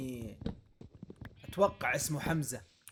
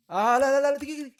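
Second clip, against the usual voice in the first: high, loud panicked no no wait; panicked yelp; 12 semitones higher, 13 dB louder, faster than usual. Real, not acted. Gamer yelling wait as danger hits, loud panic. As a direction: yelp in sudden alarm, much higher and louder.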